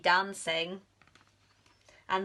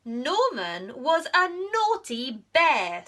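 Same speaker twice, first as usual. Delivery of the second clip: louder, firm royal scolding; stern queen; 6 semitones higher, 6 dB louder than usual. Acted. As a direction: lay down the rule. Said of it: "said the Queen".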